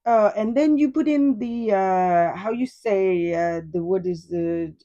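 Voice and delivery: mock Spanish accent, groping for the English word; imitated Spanish-accented speaker stumbling toward a word; thick Spanish accent. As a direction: put on a thick Spanish accent, faking a hesitant search for words. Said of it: "explaining in a thick Spanish accent".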